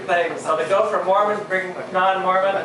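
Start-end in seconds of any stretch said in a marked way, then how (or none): none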